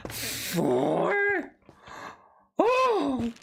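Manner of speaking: excited gasp and cry; delighted gasp. Real, not acted. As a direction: squeal at the lucky find. Finds more mushrooms.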